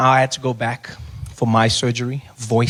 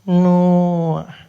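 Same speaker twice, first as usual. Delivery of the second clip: higher, louder, drawn-out "Noah"; unfriendly clerk; 4 semitones higher, 5 dB louder than usual. Retold or imitated. Check-in woman echoing surname, drawn-out higher "Noah". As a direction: stretch "Noah" out, pitched up. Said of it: "She's not friendly at all".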